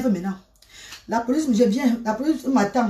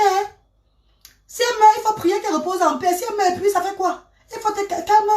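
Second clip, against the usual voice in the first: raised-pitch telling-off to calm down; lecturing neighbour; 8 semitones higher than usual. Retold or imitated. Neighbour warns the crying woman about the police, raised scolding voice. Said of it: "Elle dit".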